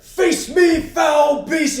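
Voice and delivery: loud high mock-heroic shout; heroic battle cry. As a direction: challenge the monster like a paladin. Paladin charging, swinging his sword.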